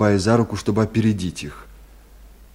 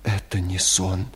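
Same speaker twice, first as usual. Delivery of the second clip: hushed, halting, short phrases; breaking voice; 4 semitones lower, 7 dB quieter than usual. A narrator's reading. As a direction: speak haltingly, shaken by what happened. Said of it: "прерывающимся голосом торопливо начал говорить". (прерывающимся голосом)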